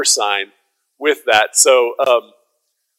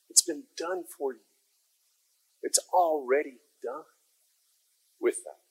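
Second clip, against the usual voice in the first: very soft, grave preaching; hushed emphasis; 3 semitones lower, 12 dB quieter, faster than usual. Real, not acted. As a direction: drop almost to a whisper for the key point. Preacher hushes for the central message.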